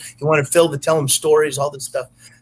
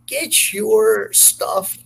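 imitating the bouncer; 8 semitones higher, much slower than usual. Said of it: "he goes".

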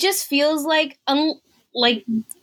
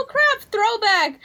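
thrilled; 9 semitones higher, slower than usual. Retold or imitated. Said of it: "I was like".

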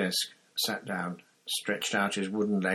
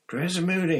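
called across a crowded room, raised and high; calling out; 11 semitones higher, 5 dB louder, faster than usual. Acted. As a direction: call out to get his attention. Harry calls out through crowd.

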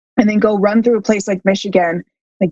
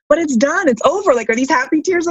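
puzzled partner; 7 semitones higher than usual. Retold or imitated. Partner voiced, higher.

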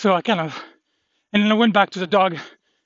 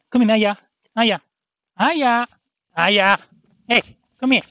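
calling the dog; 5 semitones higher, 5 dB louder than usual. Real, not acted.

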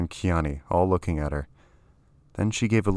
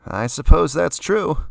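offhand, higher and louder, slowly; shrugging; 10 semitones higher, 7 dB louder, slower than usual. A narrator's reading. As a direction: careless shrug in the voice. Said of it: "said again with a shrug".

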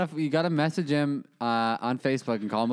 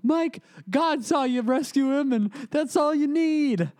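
much higher, mock soothing parent voice; consoling parent; 12 semitones higher than usual. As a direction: comfort an upset son, hammed up. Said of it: "his parents are trying to console him".

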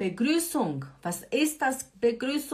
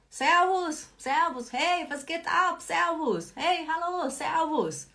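arriving greeting; 6 semitones higher than usual. Shown as a demonstration. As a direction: bounce in the door saying servus.

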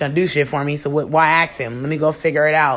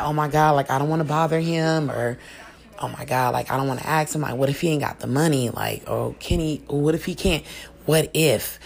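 much softer, lower, worried mutter to herself; anxious inner voice; 4 semitones lower, 6 dB quieter than usual. Retold or imitated. Imagining worrying about asking her man for help, softer and low. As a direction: hush it down like a fretting thought. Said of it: "without even thinking like".

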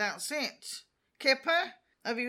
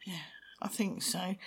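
whispering; 4 semitones lower, 10 dB quieter than usual. Real, not acted. Dreamy whispered wish.

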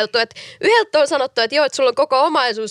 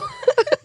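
high squeaky giggle. Real, not acted. Giggle after failed prank.

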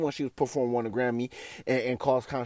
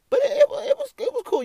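laughing; 5 dB louder, faster than usual. Real, not acted. Breaks into laughter mid-sentence.

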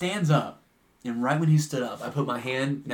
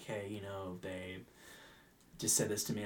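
quiet confiding; 5 semitones lower, 15 dB quieter than usual. Retold or imitated. Server quietly reports the remark.

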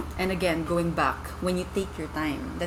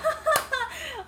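high laugh. Real, not acted. Laughs while name is searched.

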